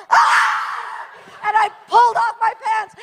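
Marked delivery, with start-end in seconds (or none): 0.1-1.5 breathy unvoiced shriek of panic; retold scream. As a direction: gasp out a scream at the mouse in your pants. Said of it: "I went".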